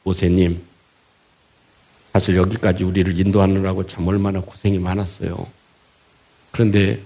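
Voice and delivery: low, restrained and deferential; understated, courteous plea kept low in pitch; mock polite complaint. Israelites politely telling Moses their hardship, low and mild. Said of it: "원망할 때 이렇게 했을까요?".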